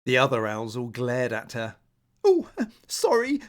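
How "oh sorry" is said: high, louder flustered laugh; embarrassed giggle. Acted. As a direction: giggle it out, caught out. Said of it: "she blushed".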